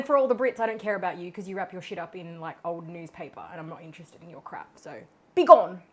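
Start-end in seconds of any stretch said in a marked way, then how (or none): 5.3-5.8 mock dismissal. Dismissing the Brits.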